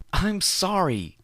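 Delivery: very high, slow wailing sorry; sobbing apology. Acted. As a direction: wail it through sobs.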